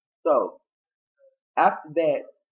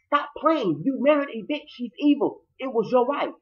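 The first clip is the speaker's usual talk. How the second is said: mock angry shout; 10 semitones higher than usual. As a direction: insist hard, pitched way up. Acted argument, high and louder.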